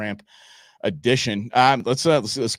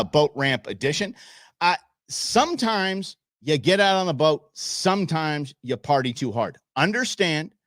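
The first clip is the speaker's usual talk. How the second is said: ordinary talk, nothing marked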